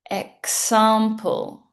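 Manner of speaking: "Example" slow, every sound clear, eks then ample; slow model word. Shown as a demonstration. Teacher models "Example" slowly for students. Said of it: "Repeat after me".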